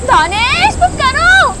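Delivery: high, rising cry for help; urgent, high-pitched appeal; panicked plea. Acted. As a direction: call out in alarm, pitch climbing. Frightened wife calls husband for help.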